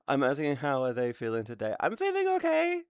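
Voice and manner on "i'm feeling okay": very high squeaky reply. Pretend. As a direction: pitch it way up like a cartoon. Answering chat in high voice.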